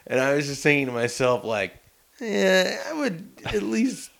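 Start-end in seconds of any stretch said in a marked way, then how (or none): 2.2-4.1 high, hesitant hedge; high-pitched, uneasy mock musing; hesitant inner thought. Retelling his uneasy inner thought.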